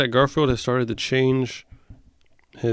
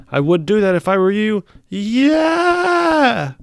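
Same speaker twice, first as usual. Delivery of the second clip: much higher and louder, ending in a yell; excited shout; 9 semitones higher, 7 dB louder, slower than usual. Acted. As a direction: build to a big shouted "Yeah". Reading Garfield then shouting.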